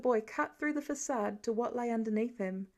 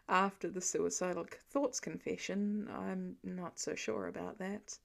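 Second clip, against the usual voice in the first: lower, softer man's voice; Clint's voice, hushed hope; 4 semitones lower, 5 dB quieter than usual. A narrator's reading. Clint to his wife Laura, lower and hushed. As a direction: hold onto a small hope quietly.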